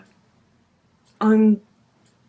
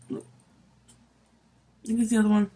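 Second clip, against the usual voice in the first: usual speaking voice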